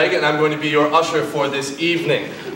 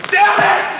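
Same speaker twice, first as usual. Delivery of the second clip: high, loud curse of frustration; frustrated cry; 6 dB louder than usual. Acted. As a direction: curse loudly at losing your chance. Frustrated as she leaves.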